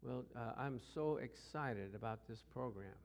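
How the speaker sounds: a lifeless supervisor mumbling "excited"; flat unexcited. Retold or imitated. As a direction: imitate a dull boss with no energy. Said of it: "He said".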